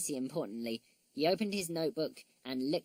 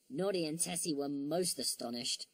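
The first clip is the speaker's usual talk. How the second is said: usual speaking voice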